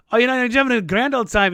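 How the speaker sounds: hamming up an Irish brogue after a few beers; mock Irish party voice; drunk fake Irish accent. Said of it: "I got pretty, pretty hammered".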